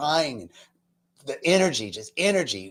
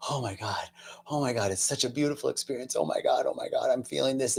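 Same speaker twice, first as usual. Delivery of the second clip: hushed, breathy awe, barely voiced; awed whisper; 7 dB quieter, faster than usual. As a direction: whisper it in wonder. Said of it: "I'm just like".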